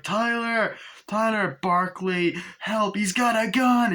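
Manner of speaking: frantic high cries for help. Retold or imitated. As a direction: call out in panic, slowing at the end. Kid screams for help.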